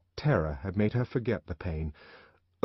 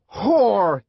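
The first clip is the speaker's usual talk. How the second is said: much higher, much louder furious shout; 11 semitones higher, 11 dB louder than usual. A narrator's reading. The savage to Lenina as he shakes her, furious shout. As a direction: spit it out as a raging shout. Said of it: "he shouted".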